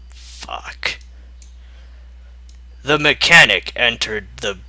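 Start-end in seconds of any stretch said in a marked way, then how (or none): 0.1-1.0 a breathless, defeated whisper; whispered curse. Real, not acted. Having read the awful fic to save the world, he whispers a defeated curse. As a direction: mutter it under your breath in despair. Despairing aside after reading.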